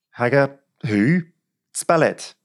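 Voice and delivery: high, incredulous query, then an order; exasperated policeman, pitched well up; baffled constable. A narrator's reading. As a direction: stretch the puzzled question, then demand sharply. Baffled policeman taking down names.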